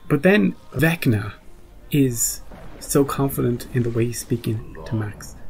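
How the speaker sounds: ordinary talk, nothing marked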